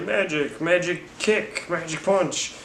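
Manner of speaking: high, playful sing-song chant; joking Spider-Man. Retold or imitated. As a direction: tease each hit in a goofy high voice. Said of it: "He's like".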